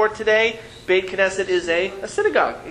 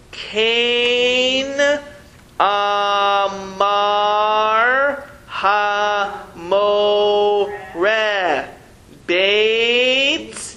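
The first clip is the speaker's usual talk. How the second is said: 1 slow reading aloud; 2 semitones higher, much slower than usual. Shown as a demonstration. Teacher reads the Hebrew line.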